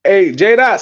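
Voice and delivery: ordinary talk, nothing marked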